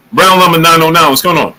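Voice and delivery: ordinary talk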